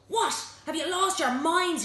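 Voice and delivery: high, loud outburst of disbelief; indignant, incredulous yell; outraged shout. A narrator's reading. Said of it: "he shouted at Sumo".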